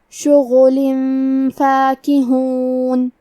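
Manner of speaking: فاکہون very slow, with a nasal hidden noon before فا; tajweed demo. Shown as a demonstration. Teacher shows students the ikhfa rule, very slow. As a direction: hide the tanween in فاکہون with nasal ghunna, slowly. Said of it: "غنہ کے ساتھ یہ حکم کو ادا کریں گے".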